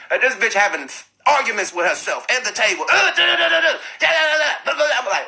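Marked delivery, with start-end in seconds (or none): 2.9-5.0 mock gibberish rant. Imitating the person arguing with the table, high gibberish. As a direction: mimic someone ranting nonsense at themselves. Mimicking the ranting passenger.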